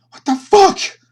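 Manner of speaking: high, loud, disbelieving shout; shocked outburst. Real, not acted. The game master reacts in shock, high and loud. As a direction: blurt it out in shock.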